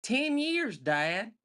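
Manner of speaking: put-on high, pointed kid voice; Ricky's hurt reply. Son Ricky correcting his father, high-pitched. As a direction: correct him sharply from up high. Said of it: "He goes".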